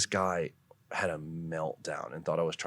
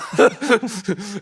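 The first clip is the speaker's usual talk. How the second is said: loud high burst of laughter; 12 dB louder than usual. Real, not acted. Laughs at own story.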